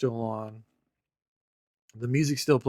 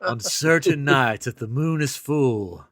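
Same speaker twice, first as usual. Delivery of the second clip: slow, louder, hammed-up campfire legend voice; mock spooky legend; 4 semitones higher, 8 dB louder, slower than usual. Host jokingly tells the legend of the abandoned car, spooky storyteller. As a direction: tell it like a ghost story at a campfire.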